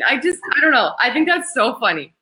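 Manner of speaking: ordinary talk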